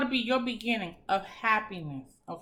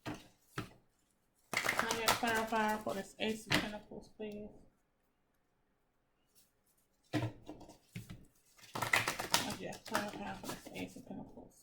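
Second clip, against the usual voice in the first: quiet request; 3 semitones lower, 11 dB quieter than usual. Real, not acted. Asking the tarot deck softly.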